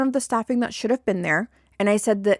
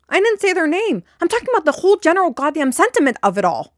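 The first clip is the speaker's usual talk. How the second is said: higher, louder, faster indignant rant; real exasperation; 8 semitones higher, 4 dB louder, faster than usual. Real, not acted. Venting to viewers about coworkers, indignant and raised. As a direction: fire off the protest in real frustration.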